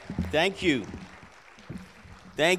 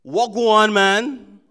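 louder Jamaican patois hello; Jamaican greeting; 5 semitones higher, 11 dB louder, much slower than usual. Shown as a demonstration. Pastor welcoming the church Jamaican style, Jamaican accent. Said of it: "is our way of welcoming you".

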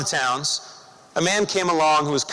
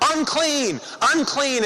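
higher, falling cry of "Unclean"; leper's warning cry; 7 semitones higher, slower than usual. Retold or imitated. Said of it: "you had to scream out wherever you went".

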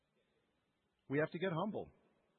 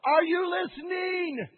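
frustrated plea, louder, slow, rising high on listening; exasperated demand to be heard; upset with God; 4 semitones higher, 7 dB louder, slower than usual. Retold or imitated. Believer venting frustration at God over unanswered prayer. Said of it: "I get really upset with God".

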